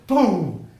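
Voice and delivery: mock impact boom; loud boom. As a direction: burst out with the hit. Kid finally hits the ball.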